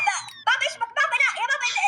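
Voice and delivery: ordinary talk, nothing marked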